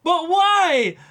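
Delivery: very high, loud whining but why; hammed-up loud complaint; mock exasperated cry. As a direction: wail it like a fed-up viewer. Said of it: "And it's like".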